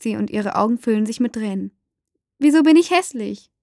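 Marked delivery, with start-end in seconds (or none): 2.4-3.5 higher, louder pained question; upset child. A narrator's reading. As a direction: plead for an answer, voice raised. Tearful child demands an explanation. (verzweifelt fragendes Kind)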